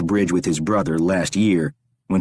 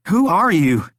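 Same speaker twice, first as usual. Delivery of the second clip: high, tense call into the dark; a bound man demanding answers, higher pitched; frightened captive Rory; 11 semitones higher, 4 dB louder than usual. A narrator's reading. Bound Rory calls out to the stranger, frightened.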